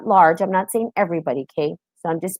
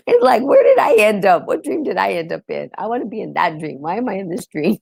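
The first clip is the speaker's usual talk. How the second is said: laughing disbelief. Real, not acted. Laughing wonder at own dream.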